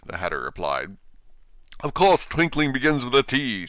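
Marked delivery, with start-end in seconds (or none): 1.8-3.7 sharply. Acted. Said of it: "said the King sharply".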